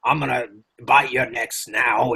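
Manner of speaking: put-on uncool Cockney accent; mock Dracula with a cheesy Cockney accent. Guest mocking Dracula's uncool British voice, Cockney accent. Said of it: "It's kinda like a Cockney accent".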